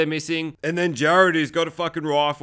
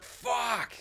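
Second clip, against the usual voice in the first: mock pained cry; 7 semitones higher, 8 dB quieter than usual. Voices Jared stabbed in leg.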